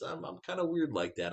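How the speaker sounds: normal talking voice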